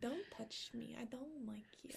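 hushed, voiceless whisper. Retold or imitated. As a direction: breathe it out with no voice. Quoted refusal whispered.

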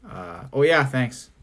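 low, trailing, underwhelmed imitation; indifferent thanks. Imitating Justin asked about his present, indifferent. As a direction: thank them with no interest. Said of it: "He's like".

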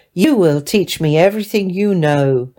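ordinary delivery, nothing marked

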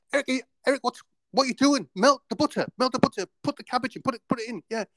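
high-pitched, harried kitchen instructions; retold panic, pitch jumping up; panicking. Cook urging student to melt the butter, high and panicky. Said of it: "and I'm panicking now".

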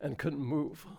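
moved near tears, halting with long pauses; choked up. Real, not acted. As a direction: hold back tears between phrases. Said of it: "I can't watch that without crying".

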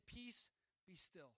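quietly commanding the storm; gentle command. Acted. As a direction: hush the storm slowly. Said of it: "he's about to step in and say".

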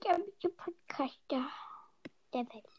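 hushed sarcastic whisper. Real, not acted. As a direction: whisper it as a snide aside.

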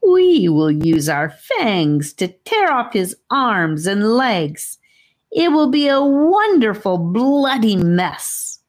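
villain snake savoring the threat; gloating snake. Acted. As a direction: relish every gory word, climbing up. Snake gloats over gory plan.

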